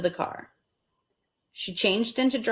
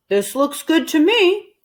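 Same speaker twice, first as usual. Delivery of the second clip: higher, louder pleased husband; Mister Rogers voiced; 8 semitones higher, 6 dB louder than usual. Mister Rogers pleased with the spot, higher and louder. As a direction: announce the good spot. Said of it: "he said".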